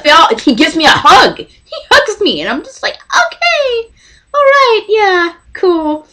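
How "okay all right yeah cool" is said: starstruck squeak. Retold or imitated. Dazed after the hug.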